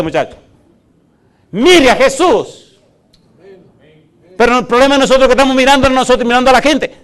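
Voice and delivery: loud, high, driving sermon voice; fiery preaching. Acted. Pastor preaching to the church at full force. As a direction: pound the point home to the congregation.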